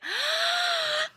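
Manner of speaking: imitated very high, giddy squeal; hammed-up little high shriek. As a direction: squeal in mock shock. Imitating a stunned reaction.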